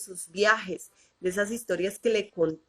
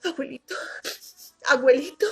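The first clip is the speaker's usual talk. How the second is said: niña sollozando; 7 semitones higher, faster than usual. Retold or imitated. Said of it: "sollozando como los niños".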